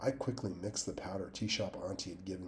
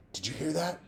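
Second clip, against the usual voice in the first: scared; 9 semitones higher, 5 dB louder, faster than usual. A narrator's reading. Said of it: "a voice said".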